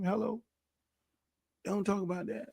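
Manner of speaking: "Hello" dropped very soft and knowing; a hushed "Hello", as if everyone should know; knowing aside. Acted. Sarcastic aside about donors.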